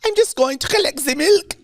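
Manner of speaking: very high, singsong put-on boy voice; mock German village boy. Teasing a friend as a German boy, high mock voice. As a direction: draw it out in a squeaky mock-German falsetto.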